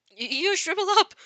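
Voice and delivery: shrill high stammered cry of shock; horrified exclamation. A narrator's reading. Izuku crying out in horror at shrunken All Might. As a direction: shriek it out, aghast. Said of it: "Izuku exclaimed before horrified".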